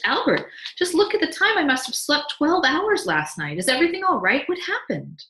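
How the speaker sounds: high, loud flustered questions; alarmed cry. A narrator's reading. Wife rushes downstairs having overslept, alarmed cry. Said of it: "she cried".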